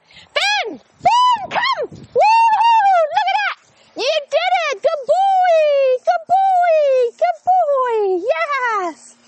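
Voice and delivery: not a drill sergeant; high, loud cheering recall; happy tone of voice. Shown as a demonstration. Trainer showing kids the happy recall voice, high loud cheer. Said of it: "happy tone of voice".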